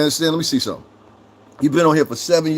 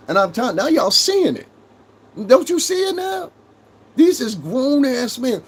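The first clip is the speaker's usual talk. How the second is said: heated rant; 12 semitones higher than usual. Real, not acted. Ranting higher at the chat.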